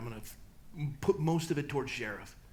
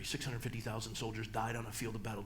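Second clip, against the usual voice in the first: ordinary delivery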